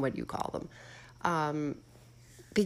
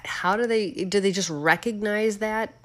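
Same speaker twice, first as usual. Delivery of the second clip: ordinary talk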